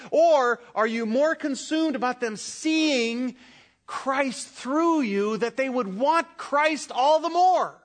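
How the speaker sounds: normal talking voice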